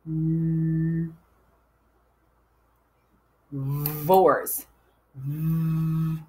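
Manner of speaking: first sound of VORES held as a long buzz. Shown as a demonstration. Teacher sounds out last syllable, holding its first sound. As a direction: hold the opening consonant of "Vores" before finishing it. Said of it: "sound it out".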